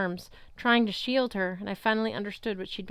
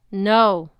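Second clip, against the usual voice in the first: insistent; 9 dB louder than usual. A narrator's reading. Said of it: "Annabeth insisted".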